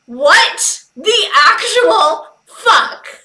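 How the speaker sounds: high, slow, loud disbelief with a laugh; appalled, laughing outburst; what the actual fuck, slowed and loud; stunned disbelief. Real, not acted. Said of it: "I'm terrified".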